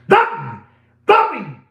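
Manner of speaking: loud high shouts of the name; calling out. Real, not acted. Dealer shouting for Bobby, loud high call. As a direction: yell for him across the room.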